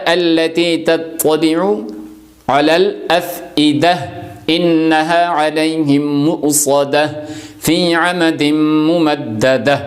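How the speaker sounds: slow, drawn-out melodic tajweed recitation; slow Quran recitation. Shown as a demonstration. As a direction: chant each verse ending slowly with the stops. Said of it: "آگے اس طرح آتا ہے". (آہستہ، لمبی تجوید والی تلاوت)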